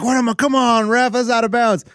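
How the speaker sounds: mock yelling dad. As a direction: yell at the ref from the stands. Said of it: "this one dad was yelling".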